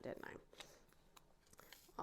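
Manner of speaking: breathy whispered self-rebuke; guilty little whisper to herself; whispered aside. Retold or imitated. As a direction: drop to a whisper, sheepish. Admits ruining the pill trick.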